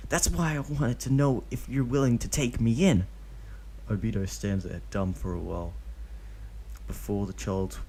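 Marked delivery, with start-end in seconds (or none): 0.1-3.1 put-on high, eager kid voice; young boy voice. A narrator's reading. Kid calling out to Obito, high boyish voice. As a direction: play the boastful kid.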